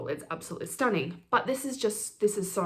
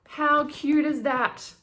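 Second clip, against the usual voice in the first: delighted; 7 semitones higher, 5 dB louder, much slower than usual. Real, not acted. Delighted at cute packaging.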